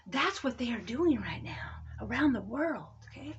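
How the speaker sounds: hushed secret whisper; soft conspiratorial whisper. Real, not acted. Confiding to brothers and sisters.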